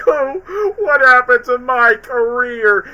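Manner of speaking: high, loud, slow hammed-up wail; mock crying. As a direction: wail it like a ruined star, hammed up. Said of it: "And then Jim was crying".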